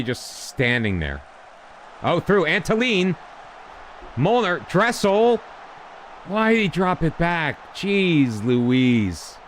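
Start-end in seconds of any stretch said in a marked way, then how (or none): 2.0-9.4 excited. Real, not acted. Reacting to a live match.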